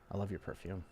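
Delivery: very soft, low, intimate line; soft compliment. Shown as a demonstration. Alternative line in the demo, said softly and intimately. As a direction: say it like a secret in her ear. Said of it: "You could be like".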